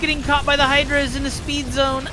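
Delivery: high, rising caster alarm; excited dismay. Real, not acted. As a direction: cry out as it gets caught. Unit caught, excited call.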